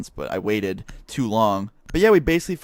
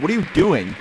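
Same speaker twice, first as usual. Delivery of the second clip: mild mock yell, higher pitched; raised-voice confrontation, retold; retold scream; 7 semitones higher than usual. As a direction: re-enact an indignant scream at reduced volume. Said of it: "I screamed at him".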